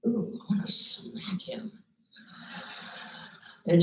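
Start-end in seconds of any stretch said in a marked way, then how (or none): 0.1-1.7 slow, hushed mock seething; suppressed anger. Group member told to look at Ronnie, seething quietly. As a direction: imitate anger starting to leak out, barely voiced.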